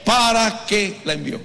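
ringing pulpit delivery; preaching. Acted. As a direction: preach the verse at full force. Preaches scripture at full force.

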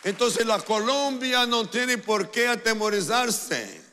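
prédica aguda y fuerte; proclamación a gritos. Real, not acted. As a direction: proclamarlo con toda la fuerza ante la multitud. Predicador proclama a la multitud. (grito de predicador)

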